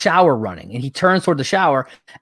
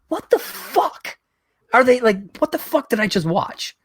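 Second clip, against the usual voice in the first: stunned disbelief; 9 semitones higher, 3 dB quieter than usual. Retold or imitated. Telling a friend about the cliffhanger, pitched-up stunned disbelief. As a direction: react like you can't believe what you just saw. Reacting to "to be continued.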